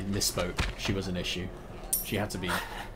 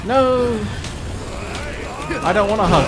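panicked shriek; 12 semitones higher, 7 dB louder than usual. Real, not acted. Fighting attacker in game.

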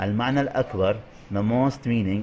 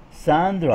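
"ساندرا" pitched up, then falling; stress on ساندرا; 5 semitones higher, 3 dB louder than usual. Shown as a demonstration. Teacher modelling a stressed name, "ساندرا" high. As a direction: call out "ساندرا" as the key word. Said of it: "ركزنا على ساندرا".

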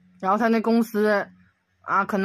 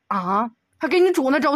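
ordinary talk, nothing marked

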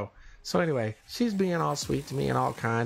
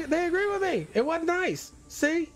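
much higher triumphant gloat. Real, not acted. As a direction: crow that they agree, much higher. Gloating at chat agreement.

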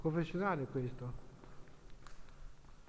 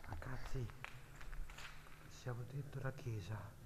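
hushed; 2 semitones lower, 6 dB quieter than usual. Real, not acted. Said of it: "Adesso facciamo silenzio".

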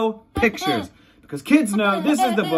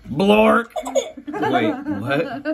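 silly voice; 6 semitones higher, much slower than usual. Acted. Silly nonsense word in book.

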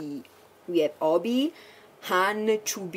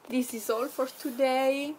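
usual speaking voice

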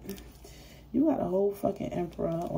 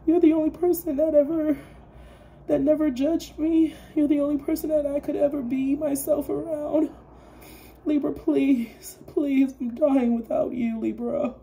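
high, loud, whiny begging ex, hammed up; mock crying plea; 8 semitones higher, 6 dB louder than usual. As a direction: beg like a pathetic ex on your knees. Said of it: "come towards you crying on their knees".

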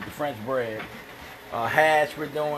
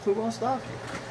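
high-pitched teasing cheer, a little softer; playful high echo; 9 semitones higher, 4 dB quieter than usual. Real, not acted. Host repeating the cheer to the cook, playful high echo.

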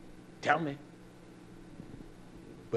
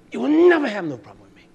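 raised voice; 9 semitones higher, 8 dB louder than usual. Acted. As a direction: pitch jumps up on "never", then falls. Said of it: "You hear my voice raise?".

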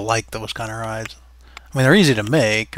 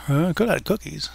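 normal talking voice

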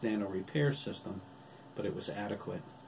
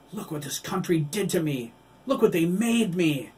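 loud, high, breaking with rage; raging yell about his body; anguished shout; 9 semitones higher, 10 dB louder than usual. Acted. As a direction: scream the blame at him. Billy shows his shattered body.

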